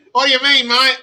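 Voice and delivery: a quick hammed-up Aussie line; mock Australian accent. Asking a listener what she means in a mock Australian accent. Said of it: "Australian accent".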